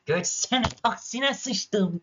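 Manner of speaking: high, slow furious yell at a taxi; shouting at the taxi that won't move; angry drawn-out yell. Real, not acted. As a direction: stretch every word out in rage. Angry at taxi blocking the road.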